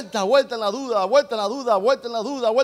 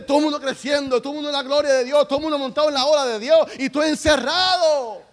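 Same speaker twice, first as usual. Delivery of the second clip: fast, rising preaching cadence, building louder; prédica encendida; 4 semitones higher, 6 dB louder, faster than usual. Real, not acted. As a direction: drive the list up to a loud final blow. (predicando rápido y con fuerza)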